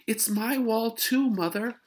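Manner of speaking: high, loud complaining call; whiny sister. Acted. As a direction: complain loudly to mother. Beezus complaining to mother.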